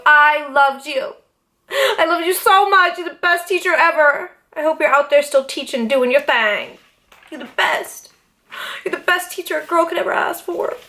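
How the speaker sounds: tender, slightly laughing thanks; sincere gratitude. Real, not acted. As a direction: thank him from the heart.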